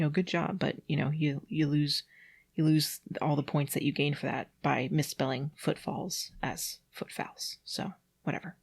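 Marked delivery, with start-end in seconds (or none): 6.4-8.6 softer, breathy throwaway; whispered aside. Real, not acted. Reader mocking the misspelling, whispered aside.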